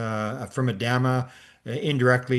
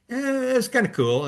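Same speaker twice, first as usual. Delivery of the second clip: noncommittal reply; 12 semitones higher than usual. Retold or imitated. Guest humors Ricardo about the flashing light, noncommittal. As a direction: squeak out a half-hearted, noncommittal answer. Faked mild approval aloud.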